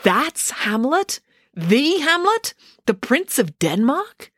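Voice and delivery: high, slow, rising disbelief; drawn-out questions climbing high at the end; shocked. A narrator's reading. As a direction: ask each bit in stunned amazement. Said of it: "Selby turned to Dan in shock".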